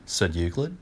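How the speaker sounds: usual speaking voice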